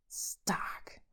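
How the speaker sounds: whispered curse; cursed under breath. A narrator's reading. Vengeful woman cursing her enemy, hushed oath. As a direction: spit the name out in a whisper. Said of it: "fluchte Wanda".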